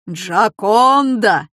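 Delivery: slow, solemn proclamation; grand announcement. Acted. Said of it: "торжественно провозгласил папа".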